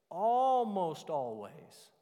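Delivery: Almost hit loud and high, drawn out slowly; preacher leaning on Almost; stress on almost. Shown as a demonstration. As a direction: punch the word Almost hard. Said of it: "See, I emphasized almost".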